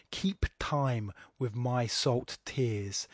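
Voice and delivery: KEEP, TIME, SALT, TEARS slow with crisp t and p; hard consonants. Shown as a demonstration. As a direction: separate each word on its hard consonant. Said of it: "hard consonants at the beginning and the end".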